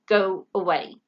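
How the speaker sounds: a hint of a gruff father; father owl sending the owlet away, mildly gruff; grumpy father owl. A narrator's reading. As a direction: brush him off.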